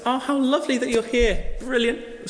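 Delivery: high, gushing posh host voice. Acted. Dinner party host greeting guests, gushing and high. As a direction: gush over the arriving guests.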